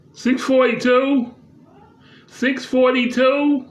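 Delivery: loud, high, drawn-out call. Real, not acted. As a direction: call it out slowly to someone across the house. Calls out numbers to someone off.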